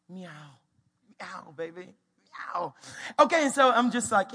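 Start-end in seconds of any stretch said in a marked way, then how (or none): 0.1-2.7 soft playful meowing; cat imitation. Dad showing toddler the cat sound, pleased meowing. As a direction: meow with cool confidence.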